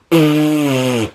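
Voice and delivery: drawn-out mock straining hum; imitated fart noise. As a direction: mimic someone leaning over to let one out. Imitates noise while leaning over.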